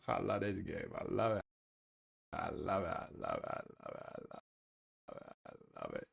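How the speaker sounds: soft, low repeats sinking to a near whisper; fading murmur. Real, not acted. Streamer murmuring love for the game to himself, fading.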